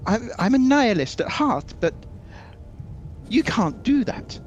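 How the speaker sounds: a high squawk of offended royalty; indignant prince. Acted.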